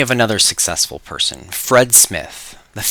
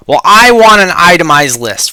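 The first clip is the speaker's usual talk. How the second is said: demanding; 5 semitones higher, 8 dB louder than usual. Acted. Fred demands itemized list.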